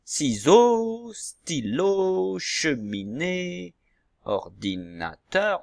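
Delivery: slow, stretched-out syllables; drawn-out syllable reading for young pupils. Shown as a demonstration. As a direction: sound out each word, holding every syllable. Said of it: "Vous avez les syllabes ici".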